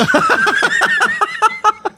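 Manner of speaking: high, prolonged real laughter; big laugh. Real, not acted. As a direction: burst out laughing at the punchline. Laughs at the punchline.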